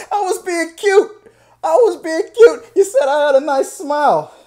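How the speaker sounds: loud, high-pitched pleading; mock protest. Rejected fan defending himself against charges, loud high protest. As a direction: plead your case at a hammed-up yell.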